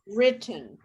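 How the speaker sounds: ordinary talk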